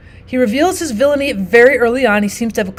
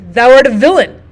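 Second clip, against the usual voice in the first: accusing line; 7 dB louder than usual. Acted. Teacher quotes Brabantio's accusation.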